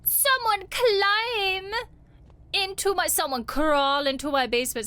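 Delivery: scared shriek about the basement; panicked cry. Real, not acted. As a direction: squeal in alarm at the intruder.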